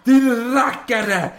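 high, loud, slow denunciation; angry accusation. Acted. Lexov shakes his fist and accuses Fersen, angry shout. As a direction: hurl the insults at him.

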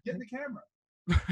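far off and faint, laughing; shouting from down the hall; from another room. Real, not acted. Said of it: "Talking from the other room".

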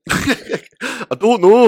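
laughing. Real, not acted. Laughing at the accent idea.

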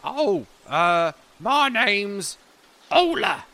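put-on high, thin voice of a fussy old gnome; a sly old gnome sizing up a tall stranger. Ola introduces himself.

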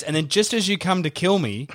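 ordinary delivery

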